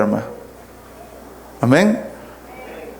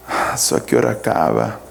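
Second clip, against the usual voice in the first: low, breath-only sighing grumble; bored listener; 4 dB quieter, faster than usual. Acted. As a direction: act a bored churchgoer sighing under their breath. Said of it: "Han escuchado ustedes mensajes donde".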